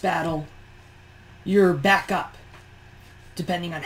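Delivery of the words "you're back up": usual speaking voice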